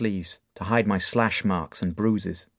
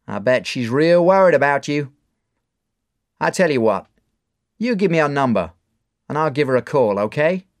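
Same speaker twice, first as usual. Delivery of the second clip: louder, higher coaxing; bar owner; 5 semitones higher, 7 dB louder than usual. Acted. Mark persuading Dave to give the number: coaxing. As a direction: talk him into it.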